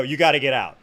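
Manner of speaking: firm, loud retold command; serious order. Officer to starstruck man being evicted, firm and serious. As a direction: lay down the order, no nonsense. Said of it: "I'm trying to be serious".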